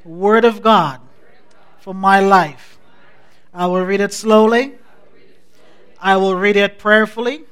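firm recitation in short phrases with long pauses; congregational declaration. Acted. Leads the congregation's Bible confession.

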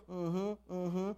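mock inattentive mm-hmm; half-listening. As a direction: hum along without taking anything in. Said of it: "They're hearing you, but they're not actually listening".

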